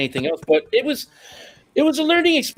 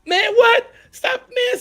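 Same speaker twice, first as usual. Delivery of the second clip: much higher, squeaky burst of surprise; retold disbelief; much slower than usual. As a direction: yelp it like you can't believe who's calling. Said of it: "and I go".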